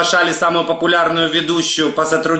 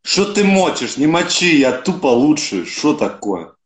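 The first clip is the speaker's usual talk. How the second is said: russian street thug. Pretend. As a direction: act like a mouthy street punk. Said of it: "Скажи, как гопник".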